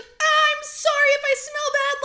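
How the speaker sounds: high, loud panicked apology, imitated; comic retold panic; mock freaking out. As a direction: blurt it out like a mortified heroine, hammed up. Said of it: "she's freaking out".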